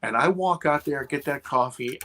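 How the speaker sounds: ordinary talk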